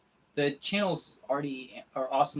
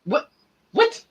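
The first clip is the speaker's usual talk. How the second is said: much higher, loud disbelieving cries; shocked; 11 semitones higher, 8 dB louder than usual. Real, not acted. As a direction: squeal out total disbelief. Shocked at Nana's cold reply.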